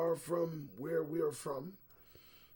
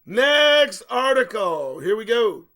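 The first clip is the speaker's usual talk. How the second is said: announcer call; 5 semitones higher, 14 dB louder, slower than usual. Real, not acted. Host announcing next segment.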